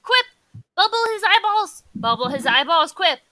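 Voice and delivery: loud shouted commands in short bursts; frantic yelling. Acted. Player trying to snap him out of it, frantic shouting. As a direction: yell orders urgently at a companion.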